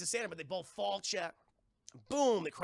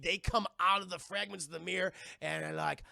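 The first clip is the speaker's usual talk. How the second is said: ordinary delivery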